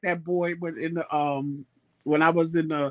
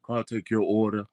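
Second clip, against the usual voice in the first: mimicking cashier; 8 semitones lower, 3 dB quieter than usual. Husband imitates the cashier's unexpected deep voice. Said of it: "I didn't expect that voice to come out of him".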